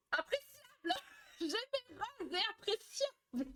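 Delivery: high, slow words broken by laughter; cracking up. Real, not acted. Reading a viewer's comment aloud, laughing high and slow. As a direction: read it while laughing helplessly.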